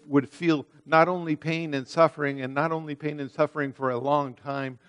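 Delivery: ordinary talk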